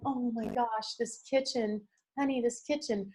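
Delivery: softly acted awe, well under speaking level; hushed admiration. House hunter to partner in the kitchen, hushed admiration. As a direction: play a client murmuring in admiration.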